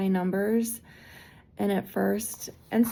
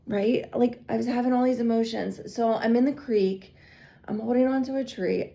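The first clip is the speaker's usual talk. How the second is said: usual speaking voice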